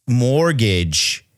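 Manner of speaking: normal talking voice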